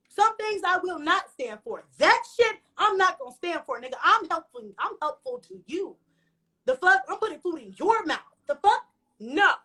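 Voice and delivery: high, indignant refusal with punched words; mad, passionate. Retold or imitated. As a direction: lay down the law, outraged. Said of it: "I was so mad and so passionate".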